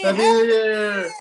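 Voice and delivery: loud, drawn-out cheer; new year cheer. Real, not acted. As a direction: shout it at midnight. Cheering at midnight.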